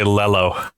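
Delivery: "lello" with an L in place of the Y; mispronounced yellow. Retold or imitated. Man quoting his young cousin, "lello" with L for Y. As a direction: start "lello" on an L, like a kid unable to say yellow. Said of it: "could never pronounce the word yellow".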